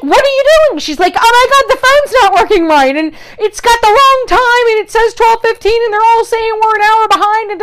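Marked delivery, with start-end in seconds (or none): 0.0-0.8 high, loud alarmed shout at Carrie; mock panicked yell, pitched way up. Friend in car asks Carrie what she is doing, alarmed shout. 1.2-7.5 high, loud panicked voice imitating Carrie; freaked-out friend. Carrie panics to her friend about the wrong time, high frantic.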